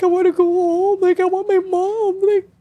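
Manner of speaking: put-on scared teen in the hospital begging for her mom, high and slow; retold scared plea. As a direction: plead to go home, high and slow like a scared kid. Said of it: "Like, I was so scared".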